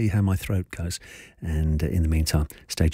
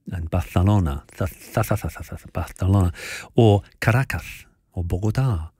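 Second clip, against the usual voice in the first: Barcelona with the Castilian lisp, Barthelona; Spanish pronunciation. Retold or imitated. DJ mocking newsreaders, lisped Spanish Barcelona. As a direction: stress the th sound in Barcelona like a Spanish speaker. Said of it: "with the accent of the country".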